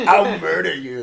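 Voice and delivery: slow mock shouted threat; embarrassing dad joke. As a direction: bellow the threat slowly, hammy. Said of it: "Classic dad joke".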